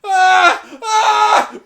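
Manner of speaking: high, very loud shocked scream; shrill panicked yell. Real, not acted. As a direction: scream out in alarm. Yelling again, laughing.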